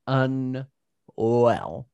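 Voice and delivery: UNWELL, stretched into two slow beats; drawn-out emphasis. Real, not acted. Agreeing with a friend about the bad kisser, drawn-out emphasis. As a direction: drag out "unwell" slowly.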